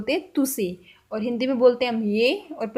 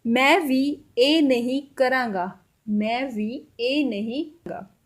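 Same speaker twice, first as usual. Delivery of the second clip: slow Punjabi demo; slower than usual. Shown as a demonstration. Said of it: "थोड़ा ज़ोर से बोलिएगा".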